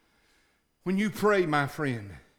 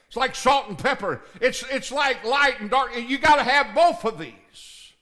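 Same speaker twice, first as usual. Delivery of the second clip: rising, higher and louder pulpit preaching; 6 semitones higher, 5 dB louder than usual. Real, not acted. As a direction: drive the point home to the congregation. Preaching to the congregation.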